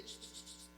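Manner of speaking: soft voiceless shushes; three quick quiet shushes; gentle shushing. Acted. God quiets a complaining believer, gentle shushing. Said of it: "a veces Dios te dice".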